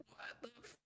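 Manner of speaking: breathless whispered curse, cut off; whispered shock. Retold or imitated. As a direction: gasp it under your breath as if you can't believe it. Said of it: "And I was just like".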